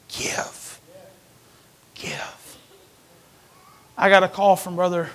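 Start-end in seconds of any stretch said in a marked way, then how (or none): 0.1-2.6 softly whispered echo. Real, not acted. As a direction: whisper the word, then again softer. Whispered echo of key word.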